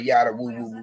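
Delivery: ordinary talk, nothing marked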